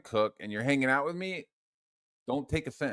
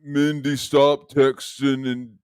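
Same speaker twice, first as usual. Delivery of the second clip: loud, slow put-on voice; Mike Wheeler; 2 semitones higher, 8 dB louder, slower than usual. Telling Mindy to stop texting, loud slow impression. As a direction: belt it out slowly in a booming impression. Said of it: "Let me get my Mike Wheeler".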